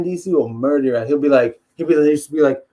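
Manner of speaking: ordinary delivery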